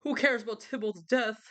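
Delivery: high, slow, theatrical lament; melodramatic Romeo. Retold or imitated. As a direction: ham up Romeo's lovesick self-pity. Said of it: "he's like".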